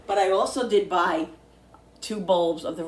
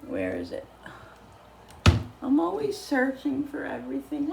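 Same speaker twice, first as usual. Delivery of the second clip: ordinary delivery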